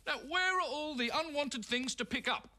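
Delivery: a hint of a cheery truck driver; mild driver voice. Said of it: "the driver asked".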